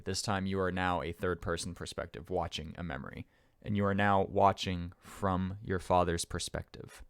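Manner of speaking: usual speaking voice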